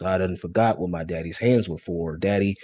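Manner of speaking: usual speaking voice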